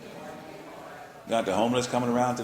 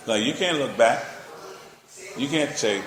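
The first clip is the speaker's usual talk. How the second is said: ordinary talk, nothing marked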